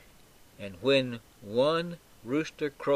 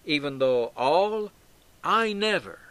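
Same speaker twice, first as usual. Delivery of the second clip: firm, raised-pitch vow, hitting "I never"; emphatic; 4 semitones higher, 5 dB louder than usual. Acted. Peter pledging loyalty to Jesus, emphatic. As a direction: declare it as an absolute pledge. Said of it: "Peter's very emphatic".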